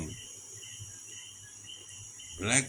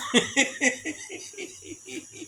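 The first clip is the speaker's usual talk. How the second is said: high laugh. Real, not acted. Laugh after the Southern quote.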